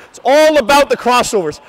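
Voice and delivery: usual speaking voice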